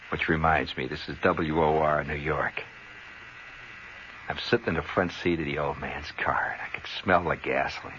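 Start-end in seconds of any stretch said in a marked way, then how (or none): 4.3-7.9 whispered. Real, not acted. Sitting alone in the car.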